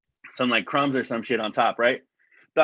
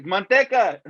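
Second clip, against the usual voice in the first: joke punchline voice; 7 semitones higher, 5 dB louder, slower than usual. Retold or imitated. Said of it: "George Lopez actually has a joke".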